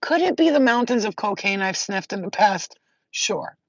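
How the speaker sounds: ordinary talk, nothing marked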